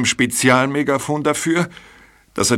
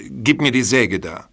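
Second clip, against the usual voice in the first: normal talking voice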